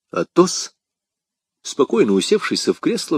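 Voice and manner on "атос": usual speaking voice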